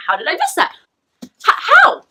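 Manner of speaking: can't believe you missed it; shocked high-pitched how; incredulous. Real, not acted. Disbelief at missed twist.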